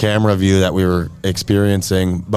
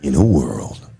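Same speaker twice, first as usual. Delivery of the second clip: deep, dramatic trailer narrator; low booming voice, like a blockbuster trailer; movie trailer voice; 8 semitones lower than usual. Shown as a demonstration. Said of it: "I'll adjust the voice a little bit until I get to my, my, uh, movie trailer voice".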